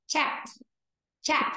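"chat" short, final t just released; quick released t. Shown as a demonstration. Teacher models for students, "chat" quick with a crisp t. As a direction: say "chat" fast in one syllable, no added vowel. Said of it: "Đọc nhanh này".

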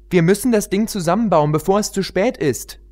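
put-on high, eager young pony voice; pony heroine. Pony heroine questioning the queen, high and urgent.

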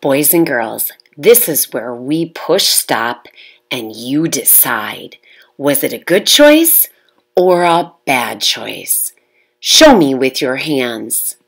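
teacher calling out to the class. Real, not acted.

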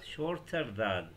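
drawn out very slowly; slow demo. Shown as a demonstration. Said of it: "واستخدم وياها كلمة than". (نطق بطيء ممدود)

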